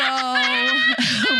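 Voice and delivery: high, loud burst of laughter; shrieking laugh. Real, not acted. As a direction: crack up out loud. Laugh burst while telling.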